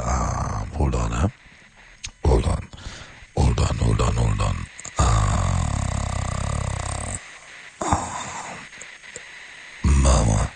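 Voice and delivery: very low, slow crooning voice; deep seductive crooner. Shown as a demonstration. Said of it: "That's what I do with it".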